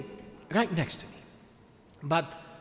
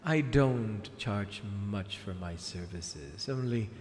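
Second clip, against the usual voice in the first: theatrical villainess, grand and scheming; sea witch voice. Acted. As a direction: purr like a wicked sea witch.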